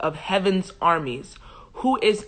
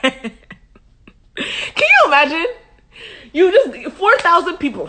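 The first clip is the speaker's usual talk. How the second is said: high, loud and laughing; laughing disbelief; 9 semitones higher, 5 dB louder, slower than usual. Real, not acted. Reader reacting to Israel being defeated again, laughing disbelief. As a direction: laugh through the words, incredulous.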